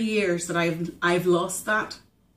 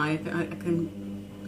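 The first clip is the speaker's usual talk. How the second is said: choked up; 2 semitones lower, 8 dB quieter, slower than usual. Real, not acted. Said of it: "'Cause I'm getting emotional".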